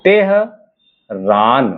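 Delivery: very slow Tehran, split as तेह... रान; drawn-out dictation of तेह, then रान; syllable by syllable. Shown as a demonstration. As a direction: say Tehran in two parts with a long pause. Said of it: "देखिएगा second place पे".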